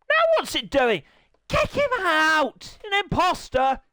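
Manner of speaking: high, loud shout at the game; loud high-pitched outcry; exasperated yelling. Real, not acted. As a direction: yell to get the intruder thrown out.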